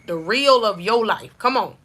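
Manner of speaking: ordinary talk, nothing marked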